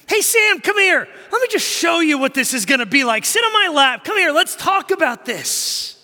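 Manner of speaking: loud, high-pitched dad summoning his son; high, pleading parental holler; urgent calling out. Retold or imitated. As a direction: call your kid over, loud and pressing.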